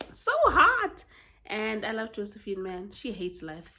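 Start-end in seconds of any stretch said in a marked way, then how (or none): 0.1-1.0 high, loud swooning squeal; gushing. Real, not acted. Reader gushing over an attractive hero, loud excited squeal. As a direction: burst out with it, thrilled.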